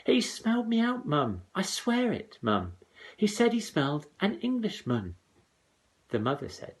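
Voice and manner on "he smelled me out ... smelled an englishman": put-on high pleading boy. A narrator's reading. Jack pleading.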